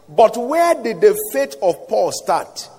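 ordinary talk

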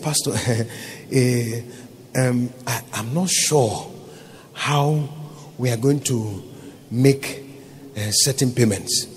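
low, soft, halting stammer; imitated hesitant accountant. Accountant admitting payment trouble to pastor, hesitant imitation. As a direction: imitate someone reluctant to finish, pausing often. Retold accountant nervously breaking bad news.

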